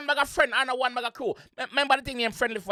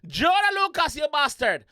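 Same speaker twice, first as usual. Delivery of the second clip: emphatic exclamation. Acted. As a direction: call out the name, then land the insult. Repeats the insult with full force.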